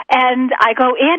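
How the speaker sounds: normal talking voice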